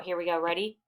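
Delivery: ordinary delivery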